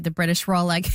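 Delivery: ordinary delivery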